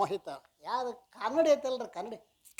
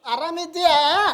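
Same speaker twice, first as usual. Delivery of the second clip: loud hearty greeting; 7 semitones higher, 10 dB louder than usual. Retold or imitated. Guru greets him after discourse.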